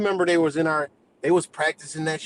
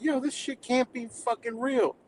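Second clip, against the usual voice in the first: pitched-up, softened incredulous reaction; high-pitched disbelief; 9 semitones higher, 4 dB quieter than usual. Retold or imitated. Streamer recalling reaction to comments, high-pitched disbelief. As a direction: protest high, as if dumbfounded.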